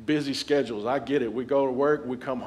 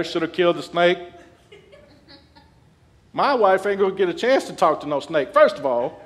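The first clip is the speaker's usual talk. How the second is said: loud, higher, joking threat; hammed-up tough guy; mock bravado; 6 semitones higher, 9 dB louder than usual. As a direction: boast like a protective husband. Joking husband would kill snake.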